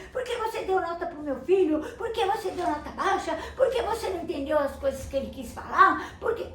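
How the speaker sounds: high, loud, furious questions; angry shouting father. Acted. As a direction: yell at the teacher over the grade. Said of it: "começou a gritar tanto com a professora".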